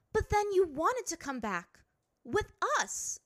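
high, sharp princess voice; a proud girl brushing it off; haughty princess. A narrator's reading. Azula challenges Ty Lee.

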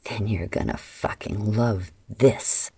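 low, slow menacing growl; growled threat. A narrator's reading. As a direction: drag it out low and dangerous. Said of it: "Adara growled".

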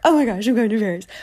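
fast, giddy chant; bubbly rushed squeal; excited. Retold or imitated. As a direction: bounce the words like a kid in line. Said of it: "just bouncing".

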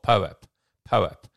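loud firm poap, "po-ap", for the transcription; drilling the word. Shown as a demonstration. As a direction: hammer poap home, "po-ap", to the AI. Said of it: "poap, P O A P".

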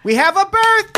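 very high, loud restaurant holler; mock birthday announcement. Waitress announcing a birthday to the room, mock high loud holler. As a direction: shout it out like an over-cheery server.

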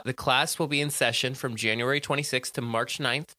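ordinary talk

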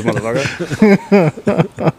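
slow stretched gangster growl; drawn-out tough guy. Acted. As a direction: drag out the insult like a thug. Mock threat line.

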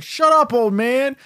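high, loud "shut up"; heckler shouting. Acted. A bar heckler yells at the drunk dad, high and loud. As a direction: bark it at the old drunk.

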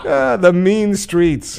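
laughing through the words. Real, not acted. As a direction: crack up while saying it. Laughing at own joke.

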